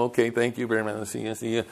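softly hurried mock thanks, as if ending the chat; quietly fob someone off, politely; polite brush-off. People getting rid of the preacher, polite brush-off. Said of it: "just try to get me away".

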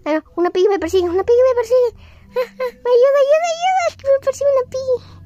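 high panicky cries for help; being chased. Pretend. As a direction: scream for help while fleeing. A piggy is chasing her.

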